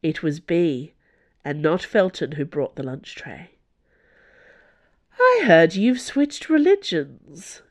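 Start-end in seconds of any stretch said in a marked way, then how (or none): 5.2-7.6 higher, slower, cheerful lilt; pitched-up, slow, cheerful taunt. A narrator's reading. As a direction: sing it out cheerfully to needle him. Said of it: "Bea said cheerfully".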